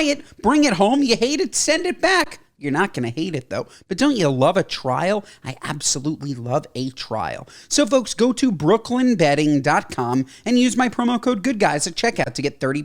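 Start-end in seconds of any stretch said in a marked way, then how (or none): 5.4-7.5 low, soft, put-on voice; mock sultry aside. Host joking to listeners about trials, soft and low. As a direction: purr it as a cheeky aside.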